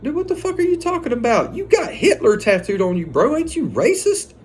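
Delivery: high, fast disbelief, voice jumping way up; incredulous. Retold or imitated. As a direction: throw it back at him in shock. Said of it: "I was like".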